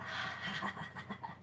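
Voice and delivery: airy laughing exhale; breathy laugh. Shown as a demonstration. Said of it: "with a big hearty laugh".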